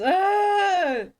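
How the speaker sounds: sharp high yelp at a bad pun; loud mock-pained yelp. Real, not acted. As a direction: yelp like the joke physically hurt. Another pun in chat.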